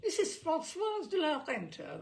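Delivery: high, loud, plummy phone voice; hammed-up grand dame introducing herself; grand lady on the phone. A narrator's reading. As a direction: announce yourself like a society hostess. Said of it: "the voice says".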